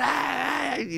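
high, drawn-out "ay" without extra volume; retold scream, high but held back; mock crowd scream. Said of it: "la gente empezó a gritar".

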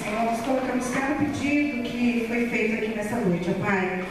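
ordinary talk, nothing marked

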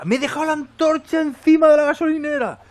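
high loud dismayed cry; shocked realization. Real, not acted. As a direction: yelp it as the mistake hits you. Realizes he left the torch behind. (grito agudo de fastidio: ¡me he dejado la antorcha!)

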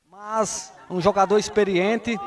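usual speaking voice

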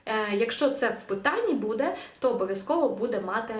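usual speaking voice

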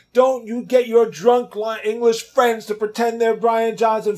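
high, loud, slow furious rant; angry girlfriend yelling. Retold or imitated. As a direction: scream it at him down the phone. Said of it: "she starts screaming".

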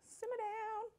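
mock baby voice telling herself off; high squeaky repeat. Cook teasing herself, high squeaky voice.

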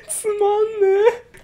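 very high whiny wail; a hammed-up tearful つまんねえ; mock crying whine. Real, not acted. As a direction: pretend to sob over a dull book. Whining at the boring book.

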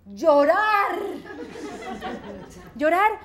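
drawn-out, loud punchline word; sudden loud reveal. Acted. Comedian revealing the best part of a jam, stretched loudly. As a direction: burst out with it and stretch it.

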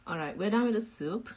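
ordinary talk

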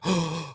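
a short, high imitated gasp of shock; mock gasp. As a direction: gasp like the customer just saw the bill. Customer's reaction to the bill.

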